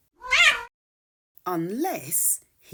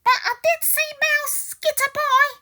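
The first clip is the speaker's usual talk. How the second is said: very high, loud, odd squeaky elephant; 8 dB louder, faster than usual. Acted. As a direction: play a funny trumpeting elephant. Elephant continues.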